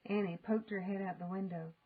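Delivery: usual speaking voice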